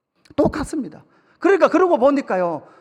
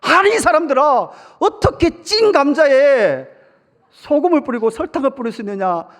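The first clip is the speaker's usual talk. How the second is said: judge snapping; 4 semitones higher, 5 dB louder than usual. Retold or imitated. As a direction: scold the couple like an exasperated judge. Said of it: "갑자기 판사님 화를". (판사가 부부를 꾸짖는 호통)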